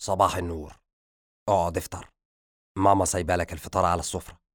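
composed, low fatherly voice; father's voice. A narrator's reading. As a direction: voice the father, a notch down in pitch. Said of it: "شمس".

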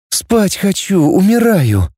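high, weary moan. A narrator's reading. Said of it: "простонала Наташа".

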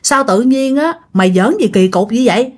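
usual speaking voice

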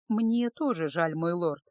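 usual speaking voice

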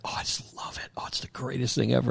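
soft dreamy whisper, gushing over it; breathy gushing whisper. Retold or imitated. Coworker Zoe gushing about ASMR videos, whispered. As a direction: whisper it like you adore it. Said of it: "Zoe's like".

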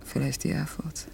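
a bare whisper, almost to herself; hushed confession of a name. Acted. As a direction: let the name slip out under your breath. Confides a rival's name quietly.

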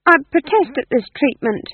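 high, loud, uncertain protest; demon's unsure objection, high-voiced; tinged with uncertainty. A narrator's reading. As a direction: protest with the pitch pushed right up. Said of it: "his voice tinged with uncertainty".

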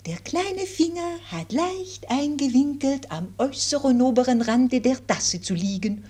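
put-on gentle governess with French accent.

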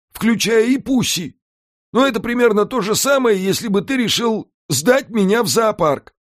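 a scheming house spirit pitching the plan; Alfred the house spirit. A narrator's reading. Alfred to Mika, laying out the island scheme, in a high lively voice.

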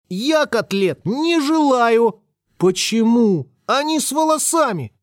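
high, slow, drawn-out refusal; capricious boy. Acted. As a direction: whine it like a spoiled child at the table. Boy refuses food at dinner.

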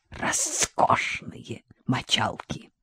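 slow sly whisper. Acted. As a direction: lean in and whisper it. Boasting about girls.

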